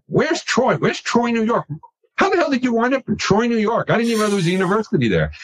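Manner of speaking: high-pitched retold bewilderment; incredulous questioning. Retelling a chat with a Southern lawyer at a conference, incredulous. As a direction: fire off puzzled questions, voice pitched well up.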